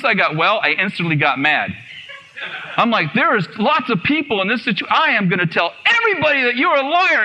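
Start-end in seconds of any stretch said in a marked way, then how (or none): 3.1-7.2 high, worked-up indignant vow; retold angry outburst; mad. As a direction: rail at a liar, pitch climbing. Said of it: "I was mad".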